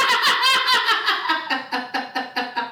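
high, long cackling laughter; helpless laughing fit. Real, not acted. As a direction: crack up at your own story. Laughs while retelling the call.

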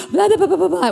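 high rapid babble; mock rambling. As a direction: gabble it in a silly high voice. Mimicking panicked rambling on call.

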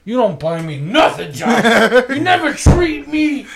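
high, slow, laughing fake whine; mock whiny complaint. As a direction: pout it out like a neglected kid, half laughing. Teasing friend about buying treats.

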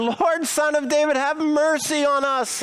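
pleading cry. Acted. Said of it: "they shouted all the louder".

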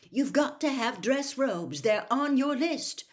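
high, loud motherly scolding; insist, losing patience; exasperated insistence. A narrator's reading.

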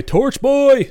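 high hammed-up summons; calling out. Arthur summoning the torch boy, high mock call. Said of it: "needs to be able to say".

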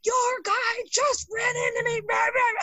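high, loud mock shout of an angry caller; imitated furious caller. Angry caller shouting at the wrong shop. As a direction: yell it like a driver who just got hit.

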